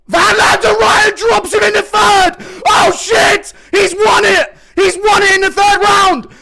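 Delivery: screaming the knockdown call; excited shout. Real, not acted. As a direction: yell it at the top of your lungs.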